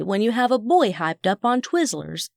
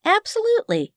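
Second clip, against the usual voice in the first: high; 12 semitones higher than usual. A narrator's reading. Narrator answers eager boy.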